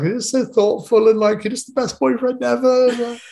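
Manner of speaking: high mock-weepy praise; imitated tearful gushing. Girlfriend praising her thoughtful boyfriend, imitated tearful gushing. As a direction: gush in a put-on high, overcome voice. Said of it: "And she cried some more".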